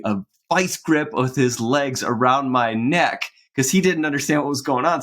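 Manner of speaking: ordinary talk, nothing marked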